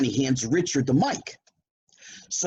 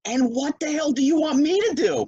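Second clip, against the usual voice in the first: panicked disbelief at being handed the mic; incredulous panic; 13 semitones higher than usual. Retold or imitated.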